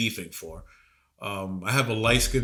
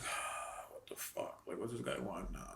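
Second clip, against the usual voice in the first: whispered, fast grumble under breath; annoyed mutter; 5 semitones lower, 21 dB quieter than usual. Retold or imitated. Teen grumbling at stepdad's call, whispered. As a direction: grumble it so nobody hears.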